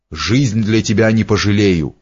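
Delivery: plain reading voice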